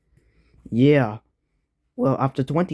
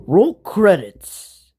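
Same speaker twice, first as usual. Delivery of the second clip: announcer; 4 semitones higher, 5 dB louder, much slower than usual. Acted. Announces the end.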